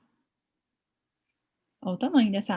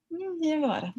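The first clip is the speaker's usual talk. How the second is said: puzzled musing; 8 semitones higher than usual. Retold or imitated. Vet puzzling over the scan, higher and wondering. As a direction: wonder aloud what comes next.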